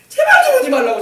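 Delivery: high, loud shout to drive him off; shrill shout. Acted. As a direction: yell it, shooing him away. Rahab driving Salmon away.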